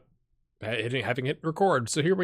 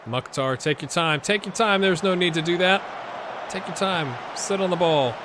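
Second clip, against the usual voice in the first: yelling from the sidelines; 6 semitones higher, 4 dB louder than usual. Real, not acted. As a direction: shout at the players to keep the ball. Coaching players through the screen.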